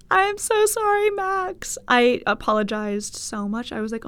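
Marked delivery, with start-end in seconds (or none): 0.1-1.8 high, squeaky plea for forgiveness; pleading, pitched far up; mortified apology. Acted. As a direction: wail the apology in a raised voice.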